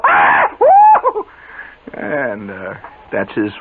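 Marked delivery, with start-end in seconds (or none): 0.1-1.2 loud high mock shrieks; ladies shrieking. Ladies at the bridge table scream at the mouse, loud mock shrieks. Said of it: "the ladies all go".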